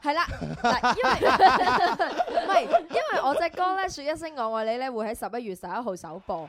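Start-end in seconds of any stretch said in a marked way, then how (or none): 1.2-2.4 laugh. Real, not acted.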